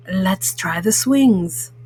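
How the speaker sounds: a mellow cool cat suggesting fun; Pete the Cat voice. Acted. Mom reading to kids, voicing cool cat Pete.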